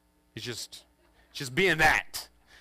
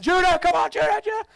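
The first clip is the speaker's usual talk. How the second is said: very high, loud yelling; screaming his name; 7 dB louder than usual. Retold or imitated. Parents screaming for their lost son, very high. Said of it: "now we're screaming".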